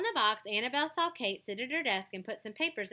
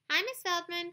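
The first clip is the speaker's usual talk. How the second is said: calling out; 11 semitones higher, 5 dB louder than usual. A narrator's reading. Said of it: "called two boys".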